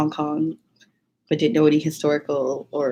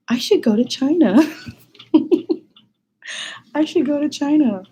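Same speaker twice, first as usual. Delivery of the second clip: higher, laughing through the words; laughing realisation; 9 semitones higher than usual. Retold or imitated. Realising after the contest, a laughing decision to go to China. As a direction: giggle out the sudden idea. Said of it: "I was like".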